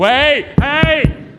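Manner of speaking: acted yell for attention; panicked calling out. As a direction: call urgently for someone to open up. Calling to be let out.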